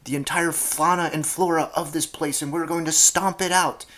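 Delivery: loud, high bellowed command; captain shouting. Retold or imitated. Pushy captain shouting down the shy player, loud bellow. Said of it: "The captain guy would shout over".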